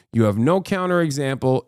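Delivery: ordinary delivery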